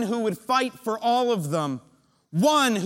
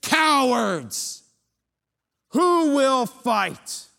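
loud, high, drawn-out jeer; a giant bellowing at a frightened army; Goliath's taunt; 6 semitones higher, 5 dB louder, much slower than usual. Acted. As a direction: roar the challenge slowly. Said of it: "and he says".

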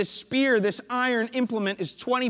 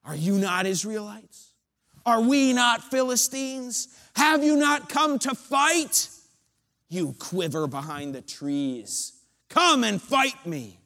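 arrogant angry giant; 4 semitones higher than usual. Acted. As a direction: bellow scorn down at the cowards. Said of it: "arrogance and anger in his voice".

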